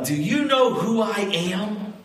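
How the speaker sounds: a mild show of pulling rank; the governor claiming rank for extra chicken; pompous governor. Retold or imitated. Governor pulls rank at picnic.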